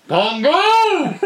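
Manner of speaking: high, drawn-out mock shout; taunting dad shout. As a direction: call it out loud to taunt your kid. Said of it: "he starts laughing. He goes".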